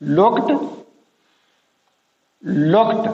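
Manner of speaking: लुक्ड ending in t, lookt. Shown as a demonstration. Teacher showing that लुक्ड ends in a t sound. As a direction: close लुक्ड on a sharp t. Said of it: "टी की sound है".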